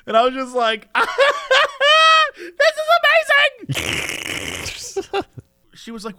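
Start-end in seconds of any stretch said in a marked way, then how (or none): 0.9-5.3 very high, loud giggling squeal; hysterical mock delight. Onlooker watching espresso tasting, hammed-up ecstatic squeal. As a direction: shriek it through gleeful laughter.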